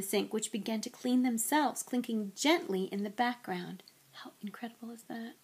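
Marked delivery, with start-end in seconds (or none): 4.2-5.4 hushed amazed aside; whispering. A narrator's reading. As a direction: whisper it in wonder.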